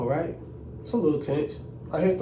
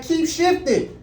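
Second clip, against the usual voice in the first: much higher insistent command; imitated examiner order; 10 semitones higher, slower than usual. Examiner pushing the driver to keep shifting, high and insistent. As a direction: snap it out, high and impatient.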